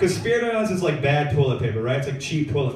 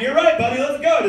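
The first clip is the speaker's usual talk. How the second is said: 1 cheerful surrender; 10 semitones higher, 5 dB louder than usual. Retold or imitated. Comic giving in.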